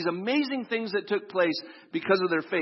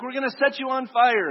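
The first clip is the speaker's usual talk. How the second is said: mock threat; 7 semitones higher, 5 dB louder than usual. Officials threaten to burn him.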